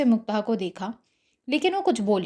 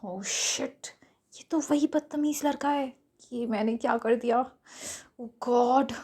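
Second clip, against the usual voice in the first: much softer, cursing herself under her breath; muttering to herself; 5 dB quieter, slower than usual. Acted. Mugdha scolding herself in her head, hushed mutter. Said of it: "मन ही मन खुद को कोसते हुए कहा".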